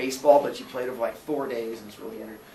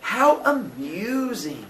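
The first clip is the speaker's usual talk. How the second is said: higher, very drawn-out delivery; amused landlady; 7 semitones higher, much slower than usual. Acted. Landlady responds to Billy's puzzle, amused. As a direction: stretch both words slowly, pitched up. Same line resumed in character.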